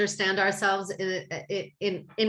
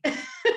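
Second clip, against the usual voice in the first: a quick breathy laugh, pitched up high; short laugh. Real, not acted. Laughing at herself for having too many favorite animals, a high airy laugh. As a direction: let a small airy laugh slip out. Laughs after saying she could go on.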